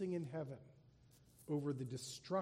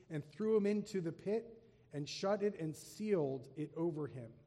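raised, solemn reading voice; scripture reading; 2 semitones higher, 3 dB louder than usual. Acted. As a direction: proclaim the passage to the congregation. Reads scripture aloud.

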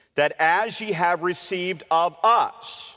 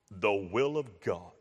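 much lower, hushed, mock-solemn preacher; deep preacher voice; 10 semitones lower, 9 dB quieter than usual. Shown as a demonstration. Preacher showing how the topic is supposedly announced, deepened voice. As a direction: drop right down into a grave pulpit voice. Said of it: "supposed to alter his voice".

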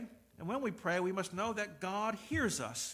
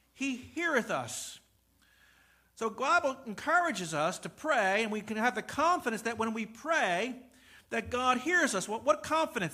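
louder, higher, building sermon; full-force preaching; 5 semitones higher, 8 dB louder than usual. Acted. Pastor urging congregation about confident prayer, full force. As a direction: drive the promise home.

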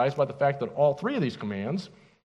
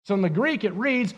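usual speaking voice

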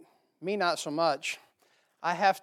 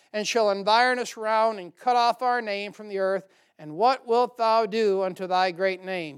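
louder, declaiming King James reading; scripture reading; 3 semitones higher, 6 dB louder than usual. Acted. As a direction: read the Bible passage out to the congregation. Preacher reading scripture aloud.

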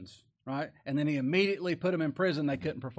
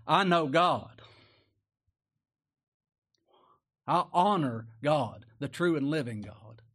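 full-voiced pulpit reading; preaching at full force; 4 dB louder, slower than usual. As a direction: proclaim it to the back pews. Preached declaration.

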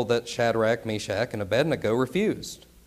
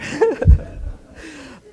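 laughing; 6 dB louder than usual. Real, not acted. Laughing at himself mid-sermon.

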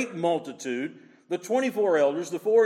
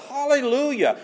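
high, loud jubilant cry; praise breaking forth; 9 semitones higher, 5 dB louder than usual. Acted. Preacher showing praise you can't contain, crying out. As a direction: let it burst out, uncontainable. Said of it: "it's just breaking forth".

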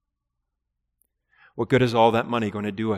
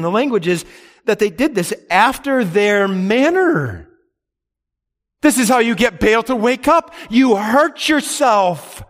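fervent preaching; 9 semitones higher, 5 dB louder than usual. Acted. Preacher warning his congregation, raised and fervent. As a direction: proclaim it like a pulpit climax. Preacher rising to full force.

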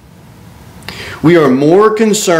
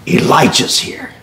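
whispered announcement; 2 semitones lower than usual. Retold or imitated. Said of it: "go tell Ahab".

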